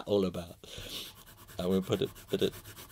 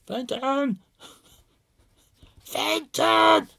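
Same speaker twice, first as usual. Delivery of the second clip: high, loud, laughing mock yell of the dog's name; imitating the owner's shout; 7 dB louder, much slower than usual. Vlogger mimics owner calling his dog, high mock yell. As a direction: call the dog like a frantic owner.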